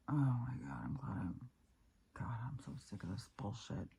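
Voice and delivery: frustrated whisper under breath. Real, not acted. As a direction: hiss it out, fed up. Muttered frustration to self.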